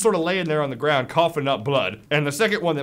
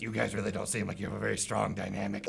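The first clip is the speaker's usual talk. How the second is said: low, soft goblin voice; hushed, low-pitched creature aside; goblin interjecting; 7 semitones lower, 12 dB quieter than usual. Acted. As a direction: butt in quietly as a goblin, much lower than usual. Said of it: "One of the goblins interjects and says".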